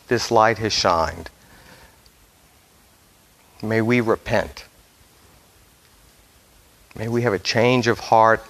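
low, hushed preaching with long pauses; solemn sermon close. Real, not acted. Preacher's solemn closing exhortation and prayer.

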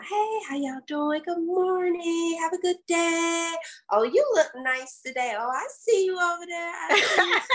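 high, sing-song Southern hello; friendly Southern greeting. Acted. Greeting passersby outside the house, friendly Southern accent. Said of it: "if you can't tell that by my accent".

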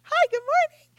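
very high, sing-out falsetto greeting; imitated wife. Wife greeting him at church, high falsetto. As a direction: chirp it in a mock wifely falsetto. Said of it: "my wife came up to me".

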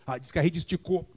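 ordinary talk, nothing marked

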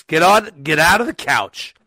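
normal talking voice

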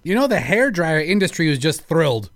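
ordinary talk

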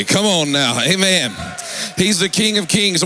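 ordinary talk

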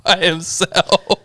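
high, slow words through laughter; laughing disbelief. Retold or imitated. Storyteller retelling the edibles reveal, laughing in disbelief. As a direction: stretch the punchline out, cracking up.

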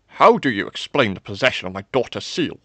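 loud, accusing king; stern demand. A narrator's reading. King confronts prisoner over daughter's seal, loud and stern.

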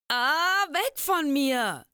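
a sudden high, loud yelp, then slow; startled cry. A narrator's reading. Nabu, startled awake, yelps at Maki to get away. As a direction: jolt awake as if stung and yelp. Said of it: "sprang, wie von der Götterbiene gestochen".

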